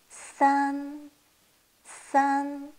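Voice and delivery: « San » tenu haut et plat; « San » sur une note haute, égale; san premier ton. Shown as a demonstration. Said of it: "Premier ton". (san au premier ton)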